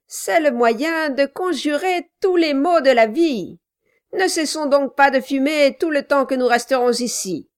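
voix plus aiguë et forte, ordres hargneux; un nain tyrannique qui menace son commis; nain brutal qui commande. A narrator's reading. Quilp gronde son clerc. (Quilp, le nain brutal, aboie ses ordres)

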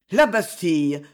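usual speaking voice

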